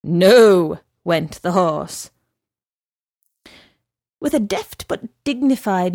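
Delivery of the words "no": firm refusal. A narrator's reading. Horse refuses firmly.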